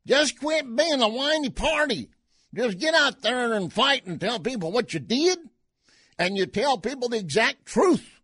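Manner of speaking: high, loud mock Cajun accent. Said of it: "in his Cajun accent".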